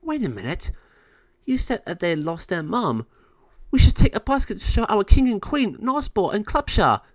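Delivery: high, loud fairy voice; eager fairy. Acted. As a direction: push the idea eagerly. Fairy Natasha proposes going to king.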